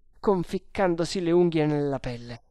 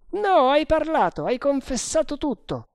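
much higher, forceful character voice; insistent sergeant; 12 semitones higher, 5 dB louder than usual. Acted. Sergeant insisting the prisoner confessed, insistent. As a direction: contradict him in a high voice.

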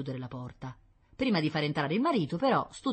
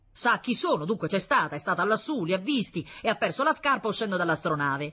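loud, higher, insistent reasoning; father triumphantly building his case; thundering accusation; 5 semitones higher, 5 dB louder than usual. A narrator's reading. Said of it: "tonò l'astuto Ulisse". (accusa tonante)

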